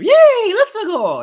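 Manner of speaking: high, loud cartoon Mario cheer; squeaky mock Mario yell. To the co-host, mocking the movie Mario's voice with a hammed-up cheer. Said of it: "and all that".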